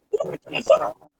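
breathy whispered repeat. Retold or imitated. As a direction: whisper it in disbelief. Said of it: "That's a reference to a skit".

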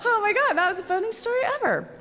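very high, loud retold amazement; retold thrilled reaction; delighted exclamation. Retold delighted reaction to sergeant's story.

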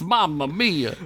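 put-on hammy Italian-American accent; mock Italian accent. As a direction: exclaim in a cartoon Italian accent. Joking ethnic accent bit.

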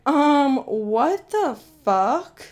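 loud, drawn-out incredulous reply; stunned mock outrage, words dragged long; retold disbelief. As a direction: stretch it out slowly in loud disbelief. Acting out her shocked reply.

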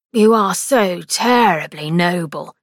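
slow, sarcastic scoff; mock her nobility slowly. A narrator's reading. Victor scoffing at his sister, sarcastic. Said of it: "Victor scoffed".

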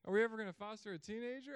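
hammed-up dread; mock panic. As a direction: back out in mock fear. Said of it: "now I'm like".

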